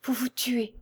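small, faint voice of dismay. A narrator's reading. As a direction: repeat it weakly, as if the voice has failed. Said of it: "mais d'une voix si faible qu'elle était à peine distincte".

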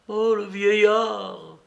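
put-on a man losing control as he recognises the old man; beside himself. A narrator's reading. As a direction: spit it out, beside yourself with rage. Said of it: "s'écria Mitya hors de lui".

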